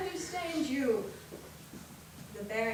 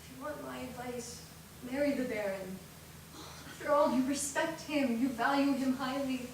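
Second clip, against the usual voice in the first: soft, low, gentle sisterly voice; tender advice; 6 semitones lower, 9 dB quieter than usual. Acted. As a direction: counsel her softly as a loving sister. Older sister gently advises.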